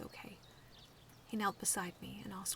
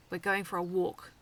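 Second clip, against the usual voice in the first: stern; 9 dB louder than usual. A narrator's reading. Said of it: "her voice stern".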